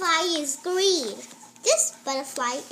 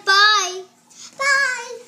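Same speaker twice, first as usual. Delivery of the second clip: loud goodbye; 6 semitones higher, 10 dB louder than usual. Real, not acted. Calling goodbye.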